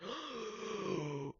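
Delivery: long drawn-in gasp. A narrator's reading. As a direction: suck in a shocked breath. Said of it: "I gasped".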